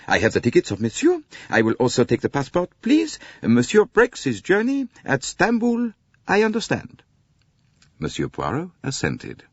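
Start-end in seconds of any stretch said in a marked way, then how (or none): 0.1-7.0 high, louder, quick French conductor voice; brisk, businesslike conductor. A narrator's reading. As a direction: snap into efficient business mode. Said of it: "became brisk and businesslike".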